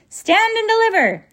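very high, loud squawk; top-of-voice bird shriek. A narrator's reading. The whiffle bird orders them to stand, shrieking. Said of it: "shrieked at the top of her voice".

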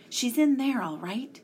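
puffing excitedly. A narrator's reading. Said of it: "he puffed excitedly".

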